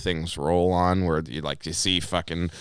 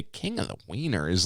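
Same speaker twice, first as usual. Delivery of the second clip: soft, questioning undertone; nearly mouthed; 8 dB quieter than usual. Retold or imitated. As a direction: imitate someone barely voicing the words. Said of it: "kinda mouth the words".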